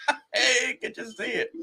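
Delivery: high, slow words through laughter; giggling through the words; laughing. Real, not acted. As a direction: chuckle it out. Laughing at rival losing.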